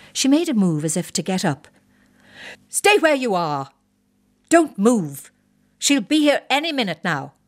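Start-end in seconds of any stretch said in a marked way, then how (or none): 2.7-7.3 officer ranting at his wife about the hairdresser; exasperated wing commander. Acted. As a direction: hammer each order home at her. Wing commander orders wife again.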